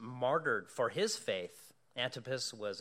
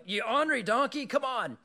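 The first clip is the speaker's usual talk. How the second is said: high, loud yell at a stubborn donkey; frustrated yell; 11 semitones higher, 7 dB louder than usual. Retold or imitated. Balaam yelling at his stubborn donkey, high and loud. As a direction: shout at the donkey to move.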